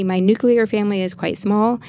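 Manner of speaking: ordinary talk, nothing marked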